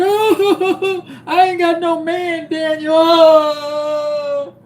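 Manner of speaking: overacted sobbing over having no man; hammed-up fake crying. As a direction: wail like a pretend heartbroken mom. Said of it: "I said".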